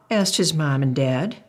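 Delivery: usual speaking voice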